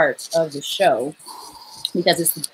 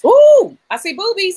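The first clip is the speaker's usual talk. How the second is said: excited squeal; 12 semitones higher, 7 dB louder than usual. Real, not acted. Excited surprise at guest.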